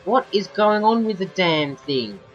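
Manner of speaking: loud, high-pitched disbelief; real exasperated bafflement, voice pushed up; exasperated outburst. Real, not acted. Baffled outburst at safety car glitch.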